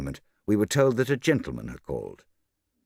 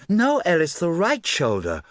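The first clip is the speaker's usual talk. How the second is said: high, loud, peevish order; impatient correction; 10 semitones higher, 5 dB louder than usual. Acted. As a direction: correct the maid sharply, hitting "right". Socialite correcting her maid.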